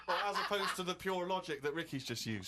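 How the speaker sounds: words broken up by laughter; amused, giggling delivery; laughing while talking. Real, not acted. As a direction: laugh your way through the jibe. Laughing at Steve's gut-feeling logic.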